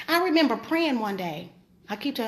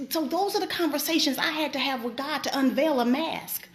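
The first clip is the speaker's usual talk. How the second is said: ordinary delivery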